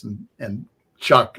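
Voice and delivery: normal talking voice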